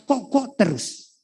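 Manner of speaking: normal talking voice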